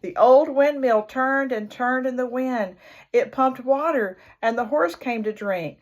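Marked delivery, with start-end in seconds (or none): none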